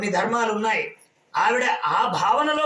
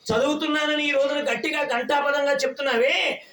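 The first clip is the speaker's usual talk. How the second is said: ordinary delivery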